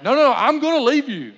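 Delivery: loud, high acted-out threat to leave; hammed-up husband losing patience; exasperated shout. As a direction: burst out at your wife, voice jumping up. Retold argument, husband threatens to leave.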